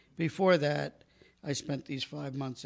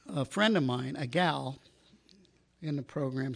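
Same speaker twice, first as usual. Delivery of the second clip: ordinary talk, nothing marked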